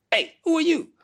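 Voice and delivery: little guy greeting. Retold or imitated.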